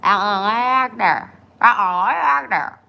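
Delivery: loud and high, mouth held wide; garbled loud words through an open mouth. Real, not acted. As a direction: say it with your mouth open at the dentist.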